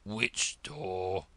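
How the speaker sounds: low, lazy frog drawl; slow frog drawl. Acted. Frog answers Alice, low slow drawl. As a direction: drag it out like a dull old frog. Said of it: "the slow drawl".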